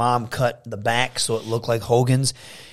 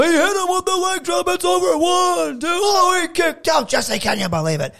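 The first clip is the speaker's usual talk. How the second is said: much higher, shouted excited play-by-play; commentator shouting. Retold or imitated. Kid calling his own teddy bear match as a shouting commentator. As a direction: yell the pin count like a frantic announcer. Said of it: "try and do all the voices".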